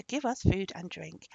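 ordinary delivery, nothing marked

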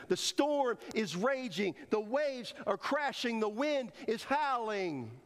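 high, slow dramatic storm telling. Acted. Preacher paints the raging storm for the church, high and slow.

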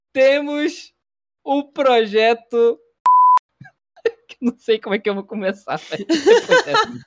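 high, loud words through laughter; laughing through words. Real, not acted. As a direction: try to restart but keep cracking up. Trying to restart while laughing.

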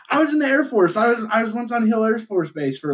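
high-pitched eager bragging; imitated seatmate. Voicing the tipsy seatmate.

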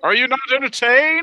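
loud, high Cockney movie quote; Cockney accent. Pretend. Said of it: "I went into a Cockney accent there".

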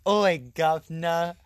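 loud, slow, hammed-up British greeting; over-the-top British caricature; mock British accent. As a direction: put on a hyped-up fake British accent. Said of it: "making fun of a lot of British people".

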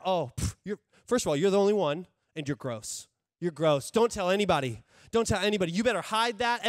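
loud, jeering, hushing warnings; scornful accuser. Acted. Pastor voicing the enemy telling you to hide, loud and scornful. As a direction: taunt them with shame and secrecy. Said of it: "the enemy".

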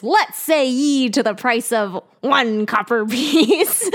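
slow, laughing mock pirate accent. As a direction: ham up a pirate accent, drawing it out. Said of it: "he puts on his pirate accent".

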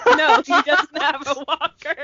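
real laughter breaking into the words; laughing. Real, not acted. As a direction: answer while cracking up. Answering joke, laughing hard.

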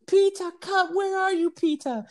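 very high, shrieky German grandma call; German old lady yelling. Retold or imitated. German grandma calls for Peter over the fence, yelling. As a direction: imitate a grandma calling out in a German accent. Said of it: "this little German lady over by the fence yelling".

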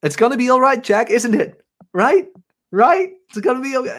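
high-pitched imitation of an anxious trainee; seeking reassurance. Nervous coworker begging Jack to confirm, anxious and high. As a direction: plead for confirmation in a mock high voice. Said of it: "she was keep asking me to confirm".

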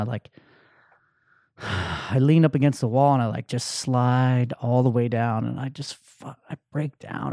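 ordinary talk

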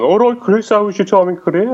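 high-pitched Brummie greeting; imitated Birmingham accent. Friends back home greeting returning Chris, broad Birmingham accent. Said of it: "back in Birmingham".

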